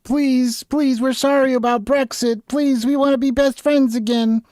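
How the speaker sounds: high, begging apology voice; pleading Britain. Retold or imitated. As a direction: grovel like a sorry ex. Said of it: "Great Britain's going back to the E U".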